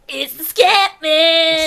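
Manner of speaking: loud, slow, gleeful shout; excited shout. Real, not acted. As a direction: announce it with glee.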